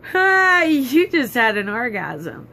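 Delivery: high, loud mock laugh, then the jeer; imitated mocking laughter. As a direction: imitate someone cracking up at you. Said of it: "She starts cracking up, fucking laughing".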